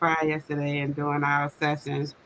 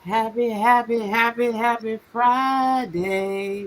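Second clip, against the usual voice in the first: sing-song greeting; 5 semitones higher, slower than usual. Real, not acted.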